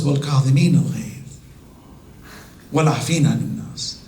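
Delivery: fast, low quoted verse; rapid recitation. Acted. The Imam quoting the verse.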